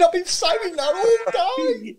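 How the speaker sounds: laughing protest. Real, not acted. Claims his pun, laughing.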